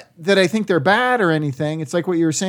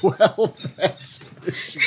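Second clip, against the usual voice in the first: laughing. Real, not acted. Repeating joke while laughing.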